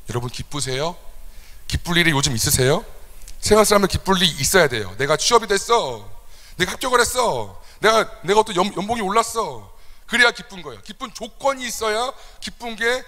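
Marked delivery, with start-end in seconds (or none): none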